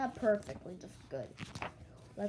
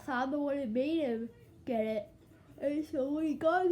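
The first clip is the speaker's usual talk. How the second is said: words stretched by a yawn; yawning while talking; 5 semitones higher, 4 dB louder than usual. Real, not acted. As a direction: talk through a yawn. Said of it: "Sorry if I'm yawning and talking".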